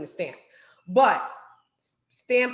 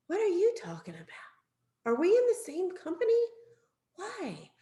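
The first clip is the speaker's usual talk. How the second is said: baffled; 8 semitones higher, 3 dB quieter than usual. Acted. Confused caller describing wrong product.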